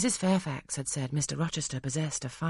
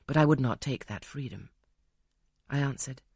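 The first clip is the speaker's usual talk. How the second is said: ordinary delivery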